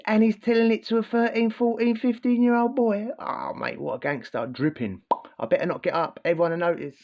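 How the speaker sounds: mother thrilled by her partner's threats, mock excited and high. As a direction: gush like a mum dazzled by a gangster. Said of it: "she's sitting there probably, mate, excited".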